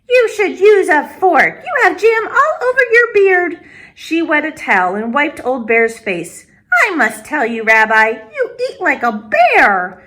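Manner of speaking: put-on high, bright old grandmother voice; old woman greeting a visitor at her door; Baba Brina greeting. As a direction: welcome the guest like a kind old granny. Baba Brina scolds gently.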